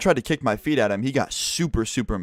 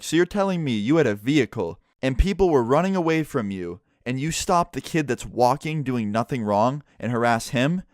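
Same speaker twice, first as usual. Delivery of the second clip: ordinary talk, nothing marked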